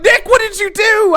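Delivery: high, loud mock outcry; alarmed teacher. Imitating gym teacher confronting him, alarmed shout.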